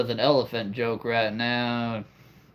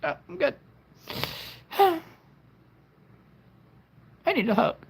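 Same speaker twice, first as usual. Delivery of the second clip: high, breaking crying voice; 8 semitones higher than usual. Pretend. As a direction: sob it out like a hurt child. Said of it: "It's my crying voice".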